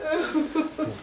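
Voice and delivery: normal talking voice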